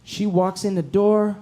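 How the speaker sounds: normal talking voice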